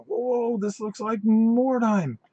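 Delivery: high, slow, drawn-out whoa of wonder; excited whoa. Retold or imitated. Seeing Mordheim-like model reveals, high and slow in awe. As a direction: marvel at the reveal, stretching it out. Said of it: "I go".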